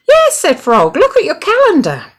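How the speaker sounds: very high, loud eager voice; excited insisting. A narrator's reading. Frog eagerly pointing Toad to the calendar. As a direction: urge him to look.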